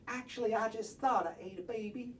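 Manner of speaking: normal talking voice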